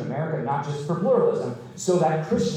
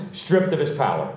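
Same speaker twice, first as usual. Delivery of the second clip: usual speaking voice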